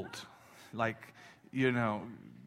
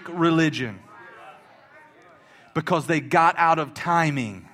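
loud, higher, driving preacher voice; fiery sermon point; preaching; 5 semitones higher, 5 dB louder than usual. Real, not acted. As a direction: build it up like the pulpit's on fire. Preaching at full force.

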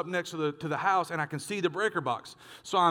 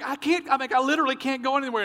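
high, rushed acted helplessness; mock panic; 8 semitones higher, faster than usual. Preacher retelling being trapped by wasps, mock panic. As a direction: blurt it out as if cornered, pitched up.

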